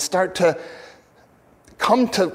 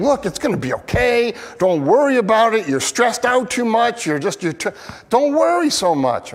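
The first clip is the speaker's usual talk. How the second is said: higher, lightly voiced friend brushing off his worry; breezy higher reassurance, hammed-up; mock comforting friend; 7 semitones higher than usual. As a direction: play the well-meaning friend saying cheer up. Friends reassuring the troubled psalmist.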